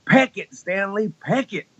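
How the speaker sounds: high, drawn-out Southern holler; high-pitched country twang, stretched out; heckling cowboy fan. Retold or imitated. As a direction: imitate a cowboy hollering at the stage. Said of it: "this guy came up ... cowboy hat".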